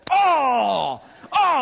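mock frustrated cry. Friends failing at maze.

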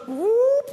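high, sliding whoop; cartoon whoosh in the voice. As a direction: swoop up high like slipping through a wall. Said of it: "He just goes".

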